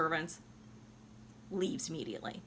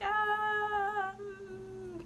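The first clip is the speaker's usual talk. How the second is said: high voice; 13 semitones higher than usual. Retold or imitated. Mock casual dying Violetta.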